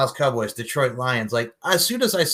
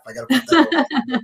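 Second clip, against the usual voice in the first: high-pitched, quick words through laughter; really cracking up while talking; laughing; 11 semitones higher, 4 dB louder, faster than usual. Real, not acted. Host praising guest's underwear stunt, laughing. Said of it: "That made me laugh".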